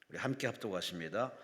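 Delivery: ordinary talk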